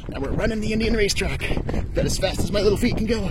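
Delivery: high, loud words while sprinting; sprinting and narrating the race; running commentary. Real, not acted. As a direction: call it out mid-run, puffing.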